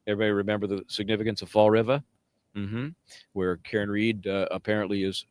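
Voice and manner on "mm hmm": ordinary talk, nothing marked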